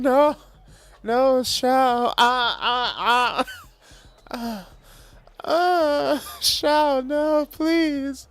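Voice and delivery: high, whimpering mock pleas, half-laughing; hammed-up pained moaning. Gamer pinned down by opponents, hammed-up high whimpering pleas. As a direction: ham up being overwhelmed, begging for mercy. Begging Shao, hammed-up moans.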